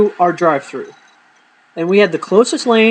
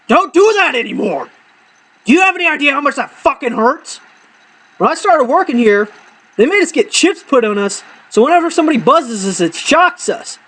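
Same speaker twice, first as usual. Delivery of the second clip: high-pitched indignant rant over a speaker; pissed-off outburst; 8 semitones higher than usual. Retold or imitated. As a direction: act out furious, pained complaint at a customer. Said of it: "the most pissed off look on my face".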